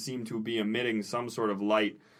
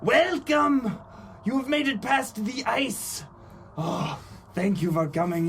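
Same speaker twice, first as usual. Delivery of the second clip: overeager cultist; 8 semitones higher than usual. Acted. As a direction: greet them like a thrilled zealot. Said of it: "he says".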